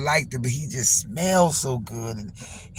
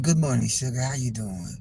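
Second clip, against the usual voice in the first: low voice-changer voice; 5 semitones lower, 5 dB quieter than usual. Retold or imitated. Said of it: "voice decoder".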